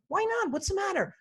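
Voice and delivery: high-pitched put-on concern; mock a sales agent's cooing sympathy; fake sensitivity. Sales agent feigning concern to an unhappy customer, high fake sensitivity. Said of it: "that fake sensitivity stuff".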